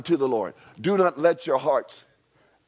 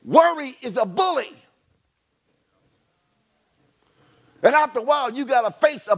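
higher and louder, declaring hard; fiery preaching; 7 semitones higher, 3 dB louder, faster than usual. Real, not acted. Pastor rousing the church against worry, loud. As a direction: preach it with full force.

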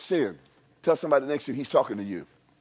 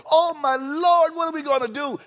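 frightened disciples; 9 semitones higher than usual. Retold or imitated. Disciples fearing the crucifixion, high mock panic. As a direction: cry out like scared disciples.

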